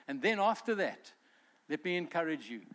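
ordinary delivery

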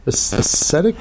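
"ascetic" as uh-SET-ic, questioning; short E ascetic. Real, not acted. Host checking the word, "ascetic" tried as uh-SET-ic. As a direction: try "ascetic" with a short E, rising. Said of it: "am I saying it incorrectly".

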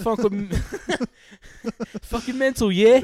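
put-on high, loud laughing British accent; mock British accent. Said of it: "Talk like this".

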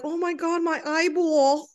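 high, drawn-out New York mom imitation; mock New York accent. Daughter making fun of mom, exaggerated New York accent. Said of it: "my mom's from New York, so we always make fun of her".

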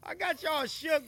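high, loud playful tease; excited teasing. Real, not acted. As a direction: crow over the nervous guests. Teasing guests after laughing.

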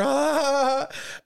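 high, drawn-out mock cackle; high hammed-up hysterical laugh; imitated harder laughing. Stoned bandmate losing control after a bong hit, mock hysterical laughter. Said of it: "he starts laughing harder".

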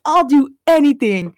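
retold begging. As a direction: imitate someone pleading to be spared. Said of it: "like, fucking begging".

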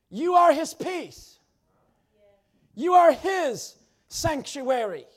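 loud, slow drawn-out preaching cadence; full-force preaching. Acted. Preacher proclaiming to the church, loud and slow. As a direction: preach it out, leaning on each "are".

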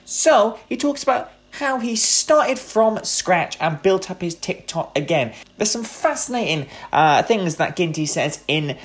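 bright, punchy announcer read of the show intro; upbeat podcast host promo voice. Acted. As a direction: sell the episode like a radio host.

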